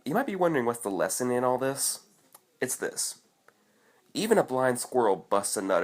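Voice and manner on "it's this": whispered. Real, not acted. Building to the lesson.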